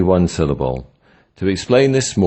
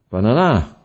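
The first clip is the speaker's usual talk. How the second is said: "banana" rising high on the final NA; ba-na-NA, "banana" stressed at the end; stress on last syllable; 5 semitones higher than usual. Shown as a demonstration. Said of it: "first and last ones".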